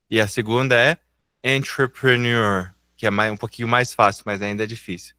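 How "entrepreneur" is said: slow, careful "entrepreneur"; slow demonstration. Shown as a demonstration. Teacher modelling the second hard word, slowly. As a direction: stretch the word out for learners. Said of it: "Eu vou falar devagar".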